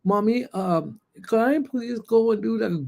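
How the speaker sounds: put-on sweet child asking; little baby voice. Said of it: "a little baby voice where she was like".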